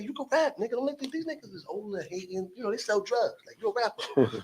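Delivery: high, light imitation of a girl; girl hyping him up. Girl telling him he can rap, high-pitched encouragement.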